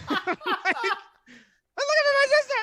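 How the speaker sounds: hammed-up London girl accent, way up high; London accent impression. Mimicking lost girl's abrupt opener, loud high London accent. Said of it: "authentic London accent".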